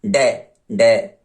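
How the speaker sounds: डे, डे stretched very slowly, sounding the D of dog; sounding out the first letter. Shown as a demonstration. Teacher isolating the डे sound of dog for students. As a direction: drag out डे twice as a phonics drill. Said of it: "डॉग".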